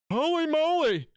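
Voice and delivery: squeaky high, hammed-up yelp of fright; mock ghost hunter. Streamer mocking the ghost hunter, squeaky mock fright. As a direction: squeal it like a spooked dork.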